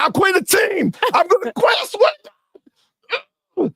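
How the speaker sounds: high, whiny mock meltdown; over-the-top high-pitched crybaby rant; crying quitter. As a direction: play a teammate throwing a sobbing tantrum. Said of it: "crying, crying".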